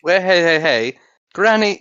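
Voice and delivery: ordinary talk, nothing marked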